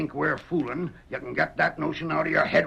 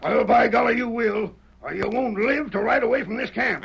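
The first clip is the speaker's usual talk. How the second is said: angry threat; 6 semitones higher, 4 dB louder than usual. Acted. Outlaw threatens captive Dan.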